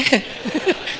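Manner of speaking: real, unvoiced chuckle; short laugh. Real, not acted. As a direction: break briefly into a laugh. Laughs at own joke.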